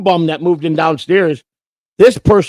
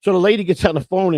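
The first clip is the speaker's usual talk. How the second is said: ordinary delivery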